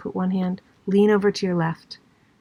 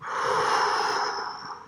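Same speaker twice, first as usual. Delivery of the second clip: long audible breath out; empty the lungs in one slow, airy sigh; whooshing exhale, no voice; 5 dB quieter than usual. Shown as a demonstration. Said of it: "wring your lungs out".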